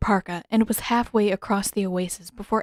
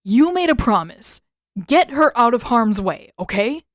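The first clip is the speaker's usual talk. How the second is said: urgent demand; 4 semitones higher, 7 dB louder than usual. Acted. Sokka pleading urgently.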